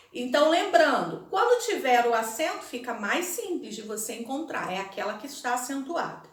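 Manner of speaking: ordinary talk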